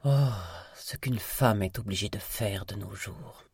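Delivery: sighed, put-upon complaint; mock weariness. A narrator's reading. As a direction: sigh it like a tedious chore. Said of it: "avec l'air de s'imposer une corvée". (fausse lassitude, en soupirant)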